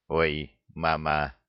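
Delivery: low, choked croak of dismay; hoarse wheeze. A narrator's reading. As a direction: wheeze it as if grabbed by the throat. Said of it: "просипел Храт, хватаясь за собственное горло". (сипло, сдавленным голосом)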